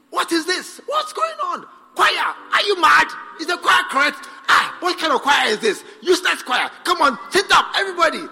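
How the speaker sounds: a pastor faking rage to get the choir in line; fake angry shout. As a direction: bark at the choir with put-on anger. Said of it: "But you must pretend".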